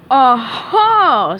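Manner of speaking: loud eureka cry; mock-detective discovery; triumphant aha. A narrator's reading. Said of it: "said Patrick".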